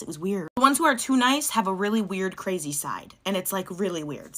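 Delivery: ordinary delivery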